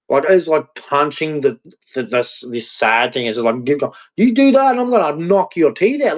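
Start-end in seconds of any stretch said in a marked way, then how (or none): 4.2-6.2 high-pitched, angry threat; threatening inner voice. Acted. Brain punching down the sad thing, threatening. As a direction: threaten like a brain bullying itself. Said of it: "that's what my brain's thinking".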